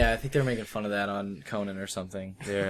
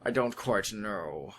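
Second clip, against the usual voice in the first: put-on Connery accent; 2 semitones lower, 5 dB quieter, much slower than usual. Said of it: "Apparently they talk like this".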